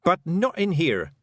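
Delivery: sharp, slow, firm; angry snap. Acted. Tiffany snapping at the Feegles in her dairy. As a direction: scold them. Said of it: "snapped Tiffany, shaking with anger".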